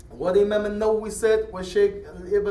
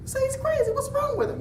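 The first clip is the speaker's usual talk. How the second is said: mocking bystander.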